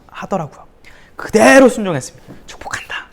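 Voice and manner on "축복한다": voiceless soft whisper of blessing. Retold or imitated. Teen to his bullies, softly whispering a blessing. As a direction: bless them in a hush.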